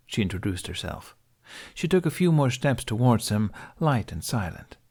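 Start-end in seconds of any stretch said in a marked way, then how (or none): none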